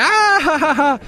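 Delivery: high, loud mock laughing; mock laughter. Friend mimics people laughing at Bush. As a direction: laugh it up like a mocking crowd. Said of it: "everybody would be like".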